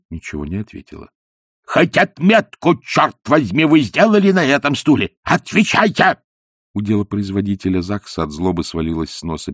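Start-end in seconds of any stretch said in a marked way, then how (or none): 1.6-6.2 furious demand. A narrator's reading. Ippolit yelling at the silent old woman, furious. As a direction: demand it, raging.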